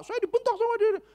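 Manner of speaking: very high, put-on whine; complaining attendant. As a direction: play the offended attendant. (tukang parkir mengadu, suara sangat tinggi)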